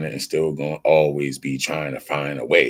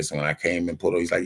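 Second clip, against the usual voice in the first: ordinary talk, nothing marked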